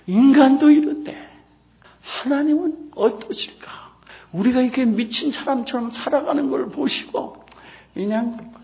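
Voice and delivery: high, tearful pleading sermon voice with whispered moments; impassioned preaching. Acted. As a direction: plead with the congregation about God's grief. Emotional pleading sermon passage. (간절하게 호소하는 설교)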